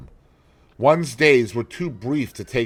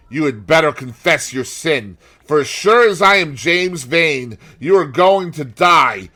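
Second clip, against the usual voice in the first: louder, higher, menacing threat; a brother swearing revenge, louder; vengeful sailor; 7 semitones higher, 9 dB louder than usual. A narrator's reading. James Vane threatens.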